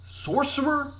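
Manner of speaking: high, rising question; questioning character voice. Acted. Character asks companion what they bring, high questioning voice. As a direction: pitch it up high as a query. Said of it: "what are you bringing to this".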